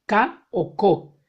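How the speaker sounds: "K o co" slow, hard k sound; French hard c. Shown as a demonstration. Teacher showing French c before o as a hard k, slowly. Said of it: "se pronuncia como una K".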